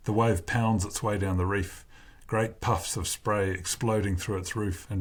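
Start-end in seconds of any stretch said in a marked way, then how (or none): none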